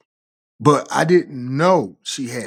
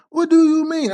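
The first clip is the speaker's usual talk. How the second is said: much higher, mock girlfriend answering; girlfriend's voice. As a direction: put on her high, excuse-making voice. Girlfriend voiced in retold quarrel.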